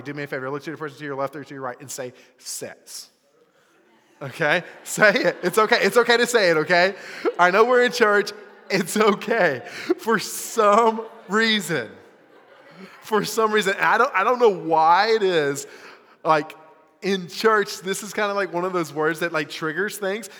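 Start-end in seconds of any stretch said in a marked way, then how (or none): 2.4-3.1 hushed, voiceless whisper. Shown as a demonstration. Preacher prompting the youth to say the word, whispered. Has audience say the taboo word. 4.8-17.8 loud coaxing. Real, not acted. Laughingly coaxing a shy church audience.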